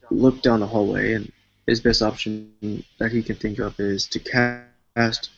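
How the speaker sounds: normal talking voice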